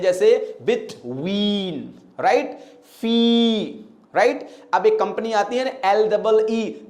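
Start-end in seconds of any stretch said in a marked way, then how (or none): 2.9-3.6 'फी' held on a long ee; long sustained ee in 'फी'; drawn-out vowel. Shown as a demonstration. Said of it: "खींच के बोलते हैं".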